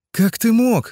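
high, loud tearful cry; tearful reproach. Acted. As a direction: plead through tears, voice rising high. Юля в слезах упрекает.

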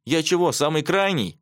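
put-on huffy boy refusing an order; snorting. A narrator's reading. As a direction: object with a scoff. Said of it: "фыркнул Андрюха".

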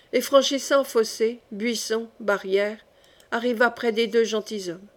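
plain reading voice

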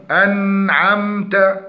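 أنعمت chanted slowly with the nun blurred into the ain; unclear nun. Shown as a demonstration. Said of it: "وعدم تحقيق الإظهار بين نون وعين". (إخفاء خاطئ للنون)

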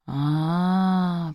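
long, softly drawn-out 'aah'; lingering, drawled interjection. A narrator's reading. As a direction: stretch the vowel out slowly. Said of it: "протянул Димон".

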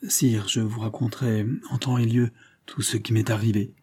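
plain reading voice

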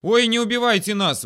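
high, loud frightened squeal; panicked screeching. A narrator's reading. Two soldiers begging not to be killed, screeching. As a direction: plead shrilly for your life. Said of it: "в голос заверещали Зюзик и Рябушкин".

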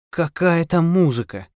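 drawn-out lament; sighing. A narrator's reading. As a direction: sigh it out, stretching the words. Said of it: "вздохнула Гаврилова".

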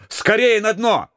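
high desperate yell; desperate shout. A narrator's reading. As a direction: cry out in panic. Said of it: "отчаянно закричал Горелов".

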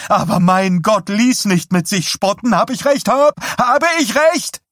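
high, loud demand climbing to a shriek; hysterical screaming. A narrator's reading. Wife demands husband admit she was right, screaming. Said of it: "schrie sie ihn an".

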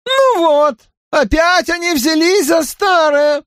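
weary second voice; exasperated man. A narrator's reading. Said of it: "Первый сказал".

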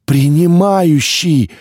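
loud desperate cry for help; shouted call. A narrator's reading. Choking narrator screams for the Receiver, loud desperate cry. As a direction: yell the name with all your strength. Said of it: "закричал я изо всех сил".

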